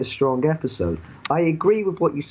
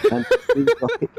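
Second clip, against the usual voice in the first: cracking up. Real, not acted.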